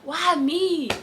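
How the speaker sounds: self-pitying. Retold or imitated. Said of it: "I'd be like".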